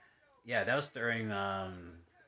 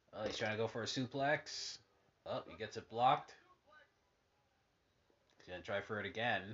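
wrestling commentator. Acted. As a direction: narrate the match, spike on the slam. Calling the match on TV.

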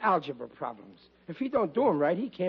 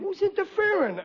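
high-pitched indignant retort; bristle, voice leaping up; indignant protest; 14 semitones higher than usual. Acted. Husband accused by wife of interfering, indignant.

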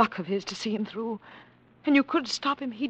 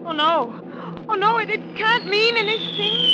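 higher, slow, frightened denial; 11 semitones higher, 5 dB louder, slower than usual. Acted. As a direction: plead against the truth. Panic as bombs come.

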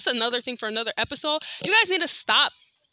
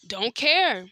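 ordinary talk

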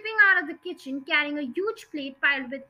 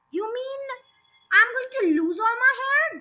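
high-pitched horrified question, getting louder; narrator's alarmed outcry; yelled; 9 semitones higher, 3 dB louder than usual. A narrator's reading. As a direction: build to an appalled yell. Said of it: "the husband yelled".

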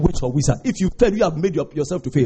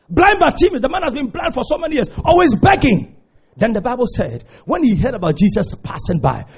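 ordinary talk

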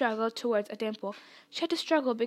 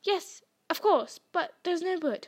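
fretful, raised-pitch protest; distressed cry; 5 semitones higher than usual. A narrator's reading. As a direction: cry out helplessly. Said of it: "Hermione cried".

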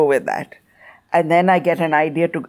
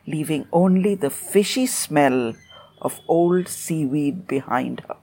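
ordinary delivery, nothing marked

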